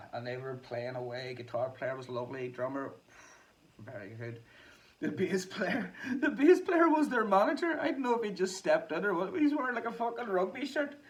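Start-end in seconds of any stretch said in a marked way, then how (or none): 5.0-11.0 high and loud through laughter; laughing. Real, not acted. Telling viewers about the band's manager on bass, laughing high and loud.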